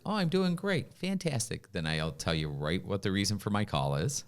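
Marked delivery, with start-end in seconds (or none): 0.0-1.7 high, bright sing-song fake cheer; mock cheery standard answer. Caller mocking a stock reply, high fake cheer. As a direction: chirp it like a canned pleasantry. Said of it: "if you give me the standard".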